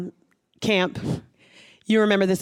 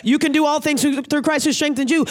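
fast, emphatic, insistent encouragement; encouraging voice of God; 5 semitones higher, faster than usual. Retold or imitated. God answering the doubting believer, fast and insistent. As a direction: push the words out quickly to lift them up. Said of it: "He's saying".

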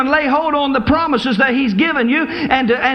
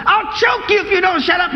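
higher, exasperated threat; mock threat; 6 semitones higher than usual. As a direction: snap back at the pest. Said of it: "you want to just say".

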